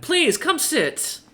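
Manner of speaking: merchant voice. Acted. Merchant invites him to sit.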